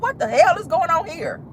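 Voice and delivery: reacting to the father's beating in disbelief; retold shock. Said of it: "I said".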